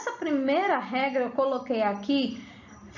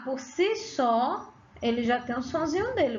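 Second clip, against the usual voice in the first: usual speaking voice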